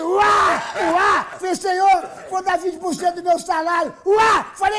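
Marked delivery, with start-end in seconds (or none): 0.0-1.2 loud high yelp as the plane shakes; hammed-up turbulence scream; mock scream. Bigger jolts. 1.5-3.9 much higher, louder bargaining with God; hammed-up begging to survive; comic panic vow; frantic mock prayer. Frantic prayer, raising the offer. 4.1-4.4 loud high yelp as the plane shakes; hammed-up turbulence scream; mock scream. Plane jolts.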